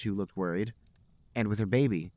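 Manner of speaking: ordinary talk, nothing marked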